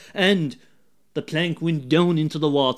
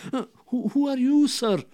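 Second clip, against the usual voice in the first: small little voice; 8 semitones higher than usual. A narrator's reading. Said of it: "says Larry in a small little voice".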